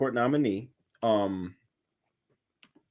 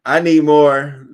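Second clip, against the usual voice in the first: slow loud "I need more"; emphatic shout; 4 semitones higher, 12 dB louder, slower than usual. Real, not acted. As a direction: demand it, loud and drawn out. Demand shouted louder.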